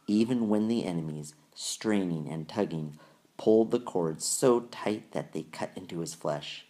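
ordinary delivery

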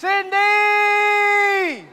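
high, loud shout across the house; calling out loud. Acted. Husband shouting for his wife Cindy, loud call. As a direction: call her name at full voice.

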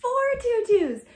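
plain reading voice

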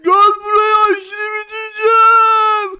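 loud, high furious yell; drawn-out raging shout; angry threat. Acted. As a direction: roar after someone fleeing. Tarık chasing with a threat.

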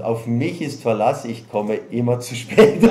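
mock proud boast, laughing; half-laughed joke quote. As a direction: play the cheerful latecomer, giggling. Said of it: "joking quote of the latecomer".

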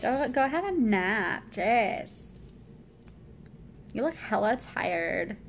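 slow, lightly voiced Valley Girl; drawn-out Valley Girl teasing; teasing her brother in a light Valley Girl voice. Shown as a demonstration. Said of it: "See, I can do Valley Girl".

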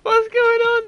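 incredulous outcry. Real, not acted. Baffled by hat on character.

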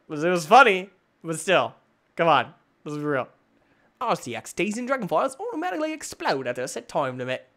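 normal talking voice

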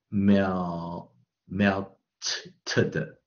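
"melted" broken up, the ending as stray "te-de" sounds; wrong pronunciation. Shown as a demonstration. Teacher showing learners a wrong melted, broken into separate sounds. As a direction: say "Mel-", then melted with a disjointed "t-te-de" tail. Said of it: "这都听起来都不对嘛".

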